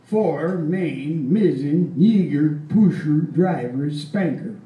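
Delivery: loud, clipped-off Swedish sailor listing the masts; stern Swedish seaman reciting masts; stern old sailor. Retold or imitated. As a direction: bark out the mast names like an old Swede. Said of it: "he told me the answer".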